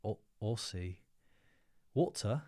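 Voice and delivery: tentative Aussie accent; attempted Australian pronunciation, unsure. Shown as a demonstration. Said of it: "let me try my, my, my Aussie".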